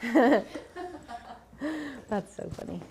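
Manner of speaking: amused words through real laughter; laughing. Real, not acted. Cracking up at the crickets.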